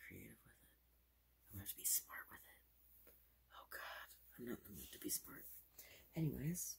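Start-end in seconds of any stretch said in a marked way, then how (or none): 1.5-5.4 fast aggressive asmr. Acted. Fast whispered asides.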